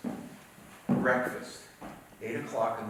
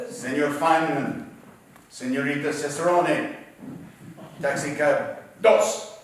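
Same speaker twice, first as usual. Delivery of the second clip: louder, imitating a public-address call; loudspeaker announcement; 3 semitones higher, 7 dB louder than usual. Loudspeaker in El Paso hotel calls passengers to cabs, imitated announcement. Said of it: "the loudspeaker would say".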